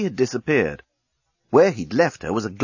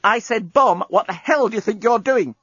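exasperated shout; 10 semitones higher than usual. Acted. Lucy repeats, angrier.

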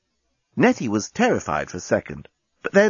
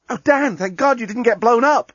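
relieved outburst; 12 semitones higher, 3 dB louder than usual. Acted. Lucy relieved, high.

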